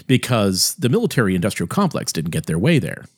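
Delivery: usual speaking voice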